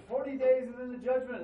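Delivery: booming prophetic warning; proclaiming shout. Retold or imitated. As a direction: thunder it out like a street prophet. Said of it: "He says".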